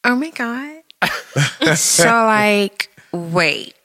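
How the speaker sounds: slow, high swoops of exaggerated disbelief; Rebecca voice. Shown as a demonstration. Showing co-hosts her work voice, put-on Rebecca voice. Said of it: "I throw on my Rebecca voice".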